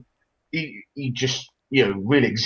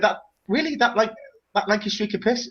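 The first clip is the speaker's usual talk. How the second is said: higher-pitched, scornful disbelief; incredulous; 9 semitones higher than usual. Retold or imitated. Boyfriend hearing of girlfriend's [name] crush, incredulous. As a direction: retell it as appalled questioning.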